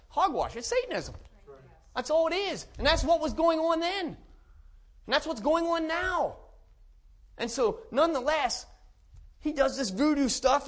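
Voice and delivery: high, loud pulpit thunder, slowing at the end; fiery preaching. Acted. Preacher warning his congregation, loud and fiery. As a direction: hammer it home from the pulpit.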